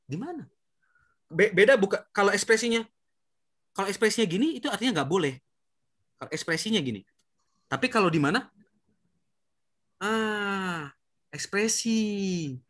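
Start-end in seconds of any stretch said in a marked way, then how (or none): 0.1-0.4 questioning face, rising tune. Shown as a demonstration. Teacher shows children the questioning expression, rising tune. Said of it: "Tapi ingat ekspresi ya". 11.3-12.6 ekspresi drawn out slowly and stressed; slow stressed word. Shown as a demonstration. Teacher stresses the key word for children, slow and drawn out. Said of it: "Semuanya bergantung ekspresi".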